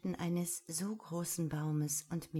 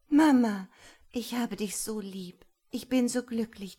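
put-on happy child; 4 semitones higher, 8 dB louder than usual. Child hugs mother.

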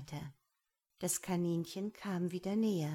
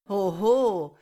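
very loud, taunting hoot; big jeering exclamation; mocking cry; 14 dB louder than usual. A narrator's reading. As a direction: crow in open mockery. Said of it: "rief der Quetzal spöttisch".

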